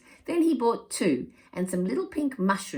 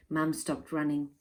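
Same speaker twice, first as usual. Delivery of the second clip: usual speaking voice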